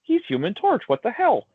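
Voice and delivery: high-pitched, protesting fan voice; comic fans. Retold or imitated. Host mimicking comic fans' objection to casting, high-pitched protest. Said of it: "They're like".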